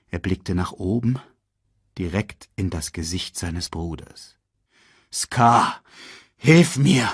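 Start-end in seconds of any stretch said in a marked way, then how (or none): 5.1-7.1 strained plea for help; a somewhat louder call to a brother; pleading call. A narrator's reading. Mufasa hangs from the rock, calling to Scar. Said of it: "rief er".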